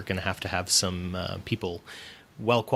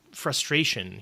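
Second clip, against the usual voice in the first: ordinary talk, nothing marked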